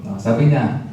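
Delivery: normal talking voice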